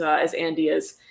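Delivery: normal talking voice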